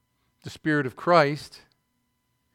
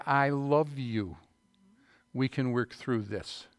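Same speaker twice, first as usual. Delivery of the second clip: slow, soft loving words to a spouse; tender vow; 3 semitones lower, 4 dB quieter, slower than usual. Shown as a demonstration. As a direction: say it gently, holding their gaze. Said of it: "look them in the eye and say".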